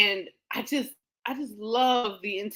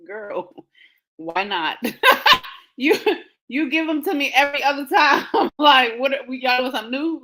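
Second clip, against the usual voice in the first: laughing exasperation; 4 semitones higher, 5 dB louder than usual. Retold or imitated. Mock outrage at the nurse.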